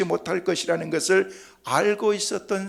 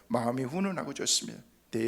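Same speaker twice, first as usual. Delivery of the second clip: ordinary talk, nothing marked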